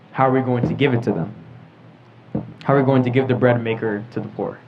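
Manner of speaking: usual speaking voice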